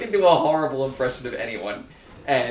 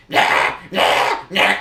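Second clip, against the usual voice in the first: lightly voiced, louder mock nah; a deliberately bad impression; horrible impression; 6 semitones higher, 6 dB louder, much slower than usual. Shown as a demonstration. Joking about bad impressions, a badly faked Willem Dafoe. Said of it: "a really horrible impression of, um, Willem Dafoe".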